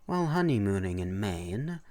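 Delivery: ordinary delivery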